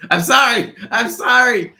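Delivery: high-pitched, drawn-out comic pleading; hammed-up squeal of apology; mock panicked apology. Acted apology to angry wife.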